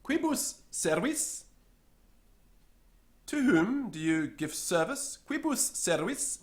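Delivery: high questioning voice. Acted. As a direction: ask it like an eager onlooker. Someone questions the secretary.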